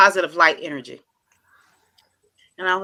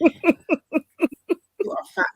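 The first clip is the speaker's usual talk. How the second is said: high, very slow, laughing through it; laughing praise; 10 semitones higher, much slower than usual. Retold or imitated. Hyping him up with a drawn-out laugh.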